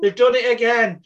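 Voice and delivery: high-pitched mock wail; put-on, high crying plea; crying child. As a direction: imitate a tearful kid complaining to Dad. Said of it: "crying and going".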